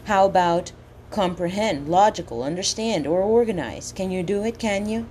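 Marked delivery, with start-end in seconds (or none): none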